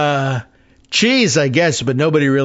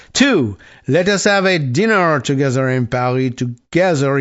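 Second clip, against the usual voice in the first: hammed-up French accent, "th" turned to "z"; Emmanuel Macron. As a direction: imitate a Frenchman, thick mock French accent. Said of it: "trying to do a French accent".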